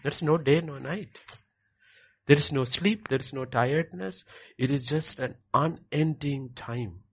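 usual speaking voice

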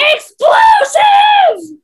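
drawn-out high robot shout; Badgie impression. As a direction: belt it out slow and high.

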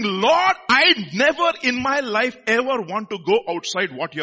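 acted loud cry to God; crying out in prayer. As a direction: cry it out to the Lord. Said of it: "with loud cries and tears".

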